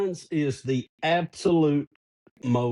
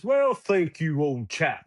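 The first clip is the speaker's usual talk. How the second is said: put-on big British accent; slower than usual. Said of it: "big British accent".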